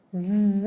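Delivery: ز buzzed softly through the teeth; buzzing z. Shown as a demonstration. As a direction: push ز out with tongue behind teeth. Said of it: "یہ ز کی آواز آنا ضروری ہے".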